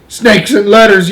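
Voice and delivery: loud, high, drawn-out exclamation; yell. A narrator's reading. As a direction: yell in shocked alarm. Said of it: "yelled the hi".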